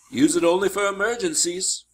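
lightly voiced warning from a tutor; cautioning mentor.

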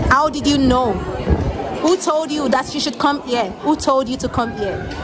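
ordinary delivery

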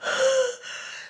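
big high gasp of air; amazed gasp. Real, not acted. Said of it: "that is so much oxygen".

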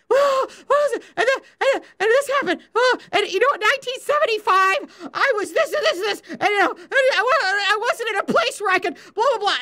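high, far-off voice of a rambling mother; imitating mom's voicemail rambling. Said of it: "audiobooks that she used to leave".

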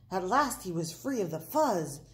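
ordinary delivery, nothing marked

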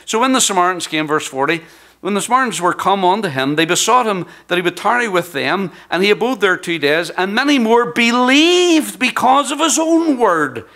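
high, loud, building sermon cry; fiery preaching. Acted. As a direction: preach it out at full force to the congregation. Preaching climax over scripture verses.

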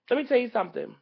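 usual speaking voice

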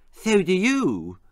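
put-on high, slow lisping child; honest little sister. Little sister answering Ginger honestly, lisped. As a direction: answer back in candid little-girl lisp. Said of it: "said Eliza honestly".

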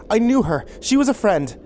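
high, loud, urgent insistence; upset protest. A narrator's reading. Upset friend protests to officer.

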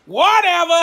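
high, loud, brushing it off; dismissive whatever. Real, not acted. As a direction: toss it away with a loud high shrug. Dismissive aside after grape remark.

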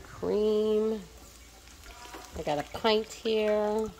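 normal talking voice

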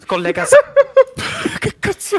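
high squealing laughter; laughing hard. Real, not acted. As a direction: crack up mid-sentence. Breaks up laughing in character.

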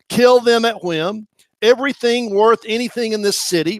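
high, loud prophetic declaration; preaching at full force. Acted. Preacher reading Jeremiah's doom over Pashur, proclaiming.

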